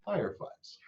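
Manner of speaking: ordinary delivery, nothing marked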